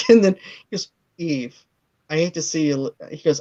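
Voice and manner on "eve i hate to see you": soft flirting. Retold or imitated. Imitates Castor's smooth flirting.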